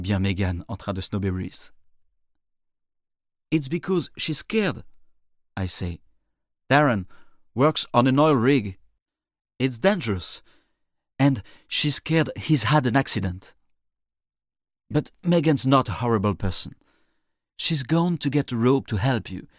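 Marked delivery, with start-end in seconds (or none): 3.5-4.8 high, urgent explanation; insistent defence. Acted. Narrator defending Megan to Reese, high insistent voice. 6.7-19.5 loud, higher insistent explanation; forceful defence. Acted. Narrator defending Megan to Reese, forceful raised voice.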